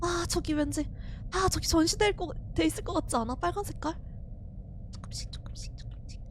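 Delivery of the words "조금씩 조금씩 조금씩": very soft breathy whisper. Real, not acted. Player creeping forward in dark, whispering. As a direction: whisper each step.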